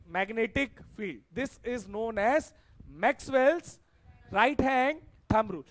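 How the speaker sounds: slow, loud dictation with pauses between phrases; recited for memorising. Real, not acted. Teacher repeating the rule for students to memorise, slow emphatic recital. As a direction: recite it in chunks, leaving each end hanging. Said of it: "एक बार repeat कर रहा हूं, याद कर लेना".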